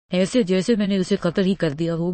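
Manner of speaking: ordinary delivery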